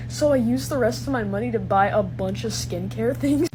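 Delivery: usual speaking voice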